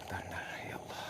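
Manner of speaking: a breathy frightened whisper; startled whisper. Real, not acted. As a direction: whisper it in alarm. Startled while changing candle.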